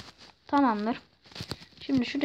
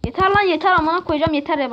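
loud, high, fed-up swearing; real gamer rage; angry outburst; 4 semitones higher, 5 dB louder than usual. Real, not acted. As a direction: snap loudly at the game. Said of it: "çok fena sinir oldum".

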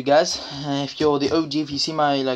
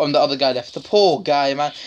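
usual speaking voice

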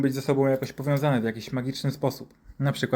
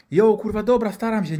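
high, loud defensive protest; acted irritated whine; exasperated doctor; 8 semitones higher, 5 dB louder than usual. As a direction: answer back as if unfairly nagged. Doctor protests mother's scolding.

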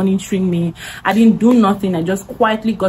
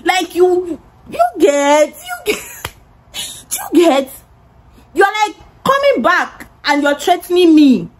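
retold screaming; 9 semitones higher, slower than usual. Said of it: "I was screaming on top of my voice".

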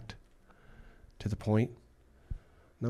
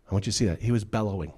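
ordinary talk, nothing marked

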